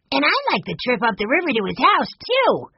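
put-on high-pitched, eager child voice; excited little boy. A narrator's reading. Benny looking forward to the river trip, excited. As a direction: pipe up like a keen small boy.

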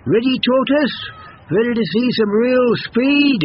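high, eager and pushy; impatient hare voice. Acted. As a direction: hurry things along. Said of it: "said the hare".

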